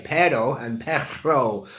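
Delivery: "pero" tapped, "perro" a laboured roll; practising Spanish R. Shown as a demonstration. Learner trying Spanish "pero" and "perro", laboured R. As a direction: attempt the roll in "perro" and struggle. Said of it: "teaching myself how to say".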